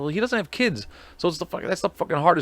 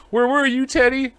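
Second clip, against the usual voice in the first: mock lament; 11 semitones higher than usual. Mock lament to fake ad man.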